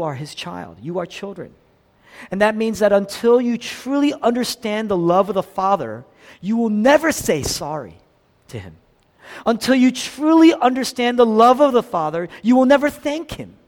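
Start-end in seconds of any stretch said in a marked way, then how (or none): 2.3-13.5 loud, higher pulpit emphasis; preaching at full force. Acted. Preacher drives home God's love to the congregation, full force.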